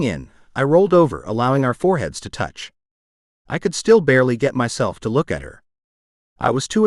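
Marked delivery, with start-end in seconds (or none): none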